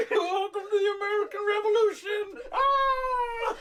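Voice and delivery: high, shouted Muppet announcement; hammed-up puppet host, ending in a wild yell; Kermit. Mocking actor as Muppet show host, high excited shout. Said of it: "He sounds like Kermit".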